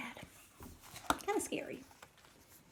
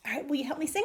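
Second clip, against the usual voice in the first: ordinary delivery, nothing marked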